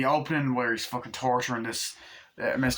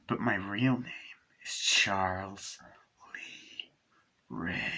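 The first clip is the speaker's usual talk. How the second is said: softer, slow menacing whisper; sinister whisper; 3 semitones lower, 5 dB quieter, much slower than usual. Retold or imitated. Chucky revealing his real name, sinister whisper. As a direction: hiss the name like a secret.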